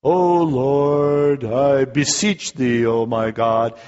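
hammed-up churchy prayer, slow and sung; mock the grand religious talk; lofty mock prayer, drawn out; pompous prayer voice. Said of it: "when we kinda do the".

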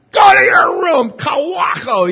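high, loud, slow furious yell; angry parent shout. Retold or imitated. Parent sending the guilty kid to his room, loud angry shout. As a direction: send the child off in a rage. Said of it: "You know".

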